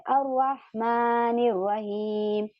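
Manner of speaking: अर्रहमानिर said slowly with the r doubled and no l; silent lam. Shown as a demonstration. Teacher shows the students the silent lam. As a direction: recite अर्रहमानिर रहीम slowly. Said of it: "तो यहाँ पे शमसी बन रहा है".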